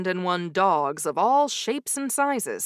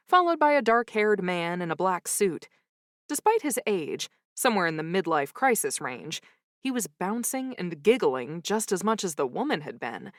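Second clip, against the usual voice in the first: ordinary delivery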